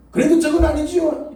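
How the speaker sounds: high, loud acted objection; loud protest. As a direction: protest at the top of your pitch. Acted protest answering God. (높고 크게 따지는 항변)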